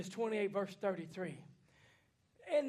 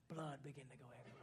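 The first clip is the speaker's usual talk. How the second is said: much lower and softer, rushed; hushed, fast; 10 semitones lower, 17 dB quieter, much faster than usual. Acted. Preacher describing the blood of the slain lamb, hushed fast. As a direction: drop to a hush on the blood. Lamb's slaughter climax.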